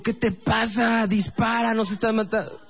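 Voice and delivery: high-pitched stretched mock yelling; frantic exasperation. Boyfriend ordering girlfriend to shoot zombies, frantic exasperation. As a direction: urge desperately, voice pushed way up.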